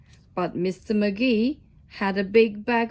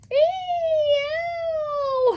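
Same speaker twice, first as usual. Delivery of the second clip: very high yelp of pain; high howl. A narrator's reading. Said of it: "howled Mister Magee".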